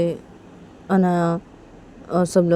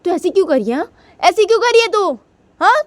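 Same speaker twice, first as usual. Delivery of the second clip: much higher, building to a furious yell; angry brother; 12 semitones higher, 4 dB louder than usual. Retold or imitated. Brother yelling at Tisha for choking Shanaya, furious. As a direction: scold her, rising to an outburst. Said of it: "Tisha के भैया बोलते हैं".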